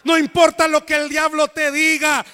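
loud, rousing sermon delivery; preaching at full force. Acted. Pastor rousing the congregation, preaching loud and full force. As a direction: preach it out to the whole church.

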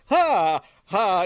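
high, loud embarrassed ha ha; fake flustered laugh. Infomercial woman laughing off a spice spill. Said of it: "she's like".

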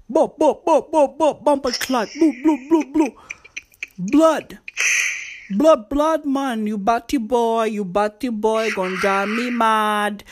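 mock Jamaican patois, high and punchy; hammed-up Jamaican patois outburst. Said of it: "That's how [name] sounds when she speaks".

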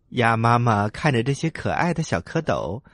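ordinary delivery

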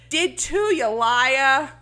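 loud, high mock Jersey kid accent; New York-New Jersey accent. Boy arguing back at his sister, loud mock Jersey accent. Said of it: "because these accents".

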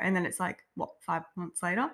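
ordinary delivery

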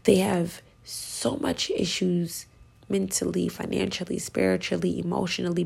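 normal talking voice

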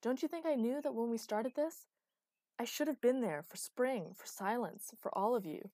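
loud, impassioned back-and-forth; angry, emphatic outburst; heated quarrel. Acted. Acted quarrel, rapid angry exchange.

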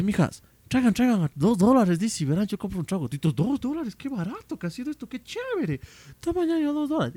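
high, eager sales pitch to friends; excited pal pitching. Retold or imitated. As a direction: urge the gang on in a high, excited voice. Acting out the keen pal's pitch.

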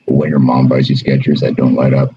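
redone voice memo. Pretend. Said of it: "I'll do it again".

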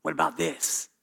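soft, sly voice of the tempter; mock whisper, sly and low; a hint of a devil murmuring in your ear; enemy whispering. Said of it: "the enemy's whispering in your ear saying".

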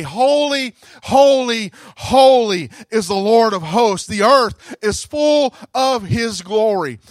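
preacher lifting the angels' cry; rising proclamation. Acted. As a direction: proclaim it to the whole church. Repeating the seraphim's cry loudly.

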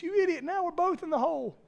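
high-pitched exasperation; man in the hole, berating his friend; exasperated. Acted. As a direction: scold him in disbelief.

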